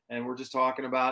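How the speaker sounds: ordinary talk, nothing marked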